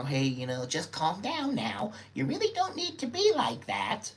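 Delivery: a hint of a soothing peacemaker; calming bystander. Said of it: "somebody tried to moderate".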